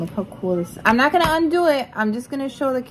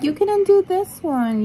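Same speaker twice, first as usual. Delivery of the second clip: higher, sing-song mock of her brother; mocking imitation; 7 semitones higher, 3 dB louder than usual. Sister mocking her brother's words to the camera, teasing sing-song. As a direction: parrot him back teasingly, a touch higher. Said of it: "He's like".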